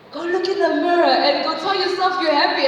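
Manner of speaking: hammed-up chirpy instructions; mock know-it-all Wikipedia. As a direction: read it like a smug how-to. Voicing Wikipedia's advice.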